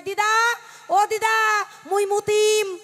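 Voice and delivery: put-on high, loud child calling out; a small boy shouting for his granny mid-song. Grandson calling loudly to granny during her kirtan. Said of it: "ডেকে ডেকে কয়".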